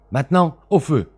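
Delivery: high, loud command; loud order. Acted. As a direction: drive him to the fire. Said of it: "dit Smith".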